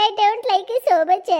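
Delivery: drawn-out, teasing taunt; slow, mocking sing-song English line. Daughter-in-law's mock English taunt.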